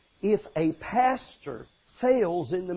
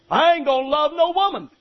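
defiant husband; 6 semitones higher, 6 dB louder than usual. Retold or imitated. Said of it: "if he said".

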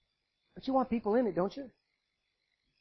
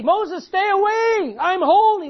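loud, high stern rebuke; harsh command; 11 semitones higher, 14 dB louder, slower than usual. Acted. God shouting at Moses to keep away. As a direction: push him away with a booming voice.

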